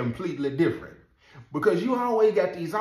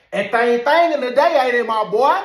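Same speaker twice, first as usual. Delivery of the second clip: high, loud mocking tease; teasing inmate; 9 semitones higher, 10 dB louder than usual. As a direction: rib the guard out loud. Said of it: "he said".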